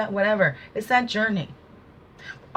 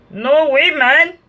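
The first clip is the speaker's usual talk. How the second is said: higher, louder mock "no way, man"; mock retort; 7 semitones higher, 7 dB louder, much slower than usual. Speaker playing someone scoffing at a taker, higher and louder. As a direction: toss it off like a cocky brush-off.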